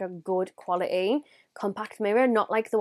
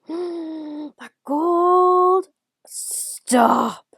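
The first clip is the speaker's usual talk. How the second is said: high gasping wonder then whispered stop; awed gasp; 7 semitones higher, 6 dB louder, much slower than usual. Real, not acted. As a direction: gasp at it, overwhelmed. Gasping at gold shade.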